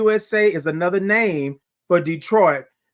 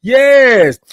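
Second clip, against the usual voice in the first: loud, high cry of approval; big belted interjection; exuberant shout; 5 semitones higher, 10 dB louder than usual. Real, not acted. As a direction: hype yourself up mid-song. Shout in own song.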